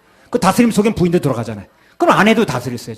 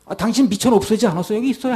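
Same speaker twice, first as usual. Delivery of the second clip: servant's excuse; 7 semitones higher than usual. Acted. Voicing the servant again.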